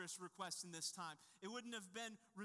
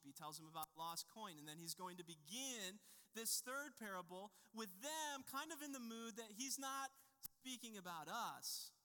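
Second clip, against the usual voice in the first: ordinary talk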